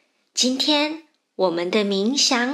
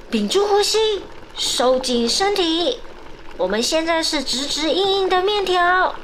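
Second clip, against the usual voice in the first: excited countdown; 6 semitones higher than usual. Acted.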